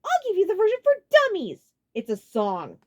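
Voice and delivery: very high, loud, mocking; condescending sarcasm; sarcastic smile. A narrator's reading. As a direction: talk down to him. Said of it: "put on a sarcastic smile".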